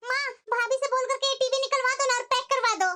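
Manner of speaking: usual speaking voice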